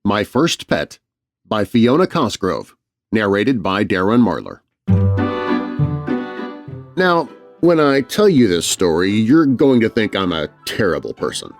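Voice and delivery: plain reading voice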